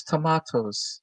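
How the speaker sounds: "tomatoes" said softly as to-MAH-toes; broad-A pronunciation. Shown as a demonstration. Co-host keeps the joke going, "tomatoes" softly said to-MAH-toes. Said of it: "I say tomatoes".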